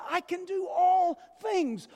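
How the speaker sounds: high, loud proclamation; shouted quote. Acted. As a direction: shout it out triumphantly.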